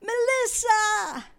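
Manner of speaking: high, loud, drawn-out call across the street; calling out a name. Retold or imitated. Girl spots Melissa from the bar, high long shout. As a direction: cry the name out slowly from a distance. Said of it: "I hear this cry from a bar".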